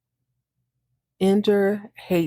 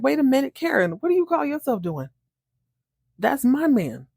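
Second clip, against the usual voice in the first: higher, claiming tone; jealous woman warning a rival; sassy confrontation; 6 semitones higher than usual. Retold or imitated. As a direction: stake your claim on the man. Abby confronting Karen, retold.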